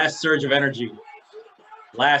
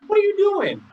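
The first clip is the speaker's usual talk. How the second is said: very high, incredulous mock wail; mock exasperation; 4 dB louder than usual. Imitating the wrestler questioning his rival, high mock exasperation. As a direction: squeal it as the baffled wrestler.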